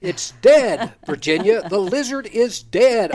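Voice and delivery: exasperated insistence. Retold or imitated. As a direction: hammer home an obvious fact. Retold insisting to the child's mother.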